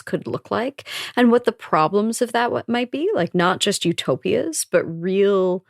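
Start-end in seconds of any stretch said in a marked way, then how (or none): none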